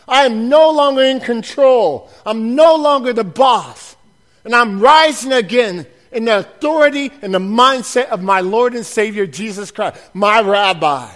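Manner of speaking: loud, emphatic sermon delivery; preaching at full force. Acted. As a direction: drive each phrase home to the church.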